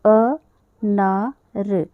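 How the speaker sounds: answering her own question slowly; slow model syllables. Shown as a demonstration. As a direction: sound out अ ना र for the class. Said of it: "इसको क्या कहा?".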